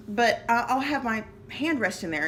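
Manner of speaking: usual speaking voice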